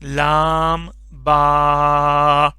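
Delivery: لام با drawn out very slowly; slow lam ba. Shown as a demonstration. Teacher models the letter pair for pupils, drawn out. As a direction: stretch لام با long and level. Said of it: "اس کے بعد ہو گیا با".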